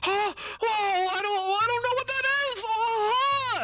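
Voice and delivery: very high, loud panicked squeal; panicked creatures. Acted. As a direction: shriek in alarm as tiny creatures. Game master voices creatures panicking.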